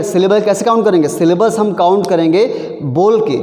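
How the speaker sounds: normal talking voice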